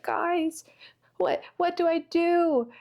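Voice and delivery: high-pitched, helpless plea; mock helpless panic. Acting out classmate with trapped mouse.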